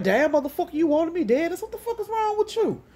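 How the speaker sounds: high-pitched, indignant telling-off; going off. Retold or imitated. Woman going off on Master P, furious. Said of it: "so I'm going off on everybody".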